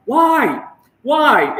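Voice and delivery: loud, high, exasperated "Why? Why?". Real, not acted. Vlogger baffled by secrecy about dating, loud exasperated cry. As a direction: throw up your hands and demand why.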